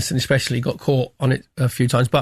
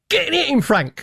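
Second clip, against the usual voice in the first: high excited call to the boxer; commentator cry; 11 semitones higher, slower than usual. Retold or imitated. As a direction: urge him on like a commentator. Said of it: "he actually says".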